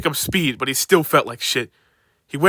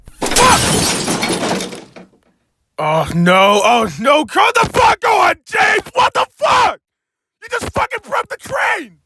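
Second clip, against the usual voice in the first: screaming high and loud; furious yelling; 4 dB louder, slower than usual. Acted. Furious workman screams at James about the crane. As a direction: scream in outrage at the clumsy engine.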